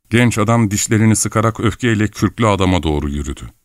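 ordinary delivery

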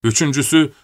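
usual speaking voice

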